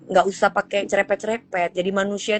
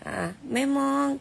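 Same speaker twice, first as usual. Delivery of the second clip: usual speaking voice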